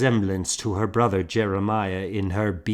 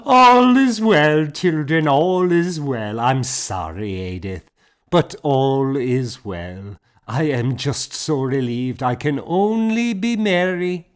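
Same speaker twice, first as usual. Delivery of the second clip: high, loud, laughing reassurance; merry, louder woman; merry relief; 6 semitones higher, 6 dB louder than usual. A narrator's reading. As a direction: bubble over with relief. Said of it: "Fiona burst into a laugh".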